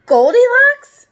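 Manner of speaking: drawn-out high shocked cry; slow, loud, high disbelief; amazed, loud and high. Acted. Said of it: "said the bear".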